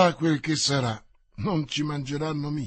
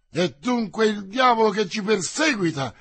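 higher, louder startled outburst; exclamation; 5 semitones higher, 5 dB louder than usual. Acted. D'Artagnan exclaims at the attacker, louder and higher. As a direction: burst out, drawing the sword. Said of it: "esclamò".

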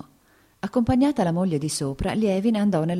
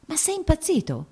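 much higher, horrified; horrified cry; 14 semitones higher than usual. A narrator's reading. Dolly alarmed at Lievin, horrified cry. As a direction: cry out in horror. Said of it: "gridò Dolly con orrore".

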